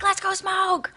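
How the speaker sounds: high, silly tempter voice; little party guy. Acted. Party guy urging the quitter to smoke, high coaxing voice. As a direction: coax like a pesky imp. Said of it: "he'd come back".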